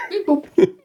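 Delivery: high, giggling repeat of the words; laughing. Real, not acted. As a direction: laugh right through it. Echoes friend's answer while laughing.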